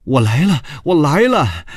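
high, loud shout to announce arrival; loud call. A narrator's reading. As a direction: call out excitedly loud, pitch leaping up. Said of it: "大声地说". (高声大喊)

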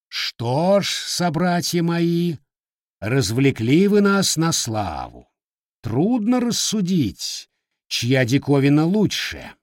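loud, slow, lordly proclamation; booming voice. Acted. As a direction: boom it out like a sea king. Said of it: "Голосище у хозяина дворца оказался густой да зычный".

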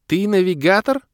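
high, loud squealed question; astonished girlish shriek; stuck in shock. A narrator's reading. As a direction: squeal it, still not believing. Kira still in shock.

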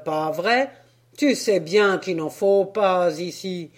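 loud, hearty sailor talking to a donkey. Acted. Sailor addressing the penned donkey, loud hearty voice. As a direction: talk like a jolly sailor.

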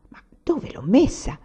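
whispered to himself. A narrator's reading. Anania searching his shirt, whispering to himself. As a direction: mutter it under the breath while searching.

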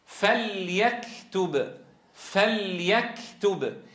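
فليكتب drawn out slowly, lam held clear and unmerged; slow recitation. Shown as a demonstration. Teacher models a verse example for the class, slow tajweed recitation. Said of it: "مثال عليها".

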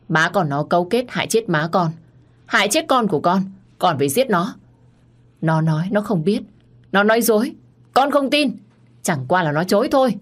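building fury that peaks at con không tin; angry outburst. Acted. Son shouting to his father that his brother lies, building anger.

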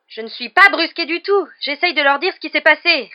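high, loud furious retort. A narrator's reading. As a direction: snap back in outrage. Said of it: "répliqua Harry furieux". (réplique furieuse)

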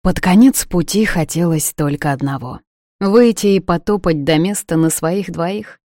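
ordinary delivery

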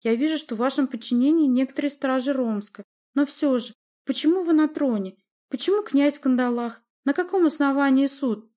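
high, loud string of questions; indignant challenge. A narrator's reading. Boyar challenges the sorceress on the throne, indignant. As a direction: press the questions in protest.

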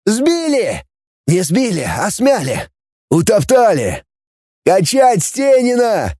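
high, jubilant exclamations; shouting crowd. A narrator's reading. Volunteers cheer the scientist's success, jubilant shouting. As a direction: celebrate like several excited volunteers. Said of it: "закричали в толпе добровольцев".

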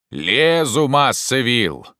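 ordinary delivery

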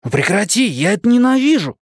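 put-on high-pitched boyish complaint; annoyed protest. A narrator's reading. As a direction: whine at him to stop it. Boy protests at cheek pinch.

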